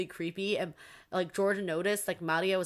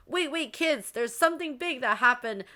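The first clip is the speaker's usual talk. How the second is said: high-pitched, louder breaking news; urgent announcement; 9 semitones higher, 5 dB louder than usual. Retold or imitated. Servants rushing in to tell the kids, urgent. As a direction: burst in with alarming news, voice pitched up. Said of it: "who's, like, rushing in".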